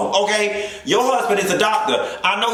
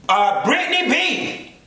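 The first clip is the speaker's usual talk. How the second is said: hype announcer; 9 semitones higher, slower than usual. Acted. Announcer intro, high slow.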